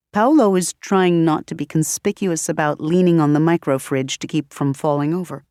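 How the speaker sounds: usual speaking voice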